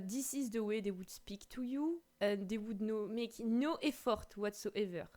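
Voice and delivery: imitating a thick French accent in English; mimicking how French people speak English, accent laid on; heavy French accent. Shown as a demonstration. Imitating French people who make no effort, in heavy French accent. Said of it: "Your French accent is so good".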